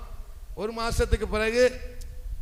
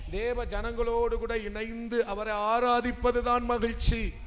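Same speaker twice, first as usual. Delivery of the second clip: emphatic preaching; 3 semitones higher than usual. Real, not acted. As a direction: proclaim it slowly, lifting the second phrase. Preacher declaring what joy is. (உயர்ந்த குரலில் அறிவிப்பு)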